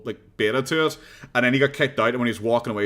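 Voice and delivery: ordinary talk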